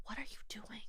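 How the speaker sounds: hushed shocked whisper. Retold or imitated. Whispering to her friend during the family argument. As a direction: breathe the question under your breath. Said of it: "I was like".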